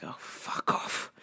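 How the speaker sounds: slow, voiceless under-the-breath curse; slow hushed retort behind the customer's back; whispered fuck off. Retold or imitated. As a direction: hiss it so he can't hear. Muttered retort under her breath.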